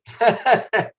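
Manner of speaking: high, loud burst of laughter; emotional laugh. Real, not acted. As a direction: laugh it off, a little choked up. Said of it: "Sorry, I'm getting a little emotional".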